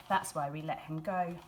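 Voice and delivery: usual speaking voice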